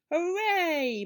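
high, loud, drawn-out cheer; delighted cheer. A narrator's reading. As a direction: whoop it out in triumph. Father cheers at the revenge story.

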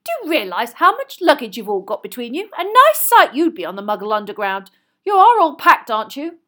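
loud, high bustling mother; fussing, forceful motherly nagging; brisk mother. A narrator's reading. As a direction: briskly take the family to task. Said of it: "said Missus Weasley briskly".

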